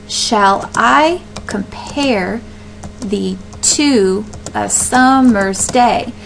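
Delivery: "Shall I compare thee to a summer's day" very slowly, beat by beat; slow, broken-up metrical demonstration of "Shall I compare thee to a summer's day"; marked stresses. Shown as a demonstration. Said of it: "put those marks in there".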